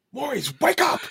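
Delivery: high, barked military wake-up order. Retold or imitated. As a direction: bark it like a drill sergeant. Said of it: "he went into his military voice".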